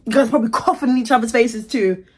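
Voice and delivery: ordinary talk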